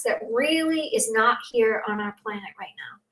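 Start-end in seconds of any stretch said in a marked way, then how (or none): 2.6-3.0 whispered aside, barely voiced. Real, not acted. Speaker to her host, whispering softly. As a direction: drop to a whisper on the last words. Hushed ending about the planet.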